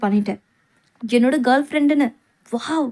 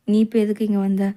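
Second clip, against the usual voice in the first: normal talking voice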